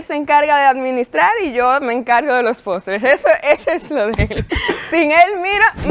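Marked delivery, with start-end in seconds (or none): 3.0-5.9 talking through laughter, pitched up; laughing at her husband's role. Real, not acted. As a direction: giggle through the words. Guest laughing about her husband.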